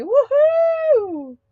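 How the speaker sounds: high, loud whoop; excited woohoo; cheer. Real, not acted. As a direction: whoop with glee at the start of the show. Host cheering at start of live.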